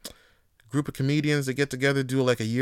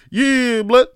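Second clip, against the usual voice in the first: hyped nod; 10 semitones higher, 10 dB louder than usual. Retold or imitated. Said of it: "he looking at you like".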